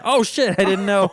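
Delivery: high, loud startled outburst; mock shock. Comic acting out a nearsighted friend caught off guard, mock shock. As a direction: play a suddenly startled man, hammed up.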